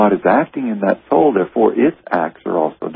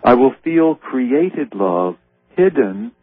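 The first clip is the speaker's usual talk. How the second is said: lifted, unhurried reading of a holy text; slow prayerful reading over music; slow devotional reading; 4 semitones higher, slower than usual. Acted. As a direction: draw out each phrase like a prayer. Reading Jesus' words slowly.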